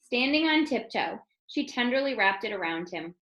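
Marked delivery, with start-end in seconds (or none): none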